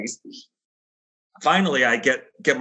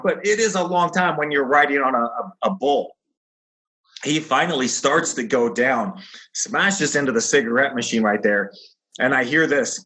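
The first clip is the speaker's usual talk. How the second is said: ordinary talk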